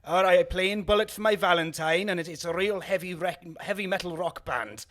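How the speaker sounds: a half-hearted stab at a Welsh accent; Welsh accent attempt. Shown as a demonstration. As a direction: have a go at sounding properly Welsh. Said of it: "um, I'll try and do it now".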